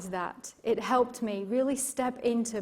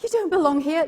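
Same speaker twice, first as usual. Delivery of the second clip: inner critic voice; 7 semitones higher, 6 dB louder, faster than usual. Retold or imitated. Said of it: "this little voice inside of my head".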